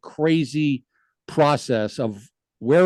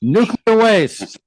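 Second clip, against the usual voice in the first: dramatic echo; 6 semitones higher, 6 dB louder, slower than usual. Retold or imitated. Echoing the clip's dramatic line.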